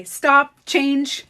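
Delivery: loud, high "Stop, change" like a slogan; emphatic command. Shown as a demonstration. She recites her catchphrase to viewers, loud and punchy. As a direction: bark out the mantra. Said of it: "all of you guys know that I say".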